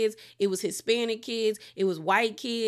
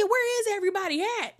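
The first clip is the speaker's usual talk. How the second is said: incredulous; 11 semitones higher, faster than usual. Retold or imitated. Incredulous at the show's lineup.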